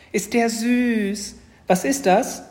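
put-on high, loud, drawn-out little-girl gushing; thrilled little sister; delighted squeal. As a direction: coo loudly over a cute animal. Sister first sees the creature.

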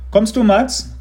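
father calling across the lot; Papa calling his son from afar. A narrator's reading. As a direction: call out loud and high to Mats. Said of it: "rief Papa".

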